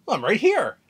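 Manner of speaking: lightly voiced carefree Luffy reassuring her; Luffy telling Bonnie he was always there, cheerfully. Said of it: "And Luffy goes".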